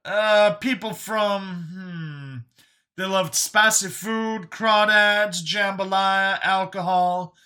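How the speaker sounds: high, loud character voice feeling for words; high-voiced man groping for a forgotten name; Brian reminiscing. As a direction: pitch it up, drawing words out as you recall.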